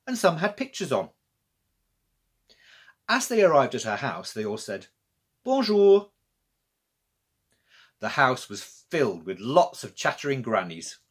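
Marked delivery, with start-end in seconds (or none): 5.4-6.1 cheery chorus greeting. Acted. Grannies greet together.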